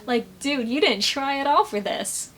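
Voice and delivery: usual speaking voice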